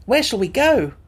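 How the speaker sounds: a touch of excitement; lightly voiced eager Tip. A narrator's reading. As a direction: ask keenly. Tip asks.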